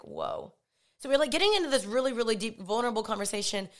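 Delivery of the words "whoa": very low, hushed whoa; stunned whoa. Retold or imitated. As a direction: let it drop, quietly taken aback. Stunned reply to her confession.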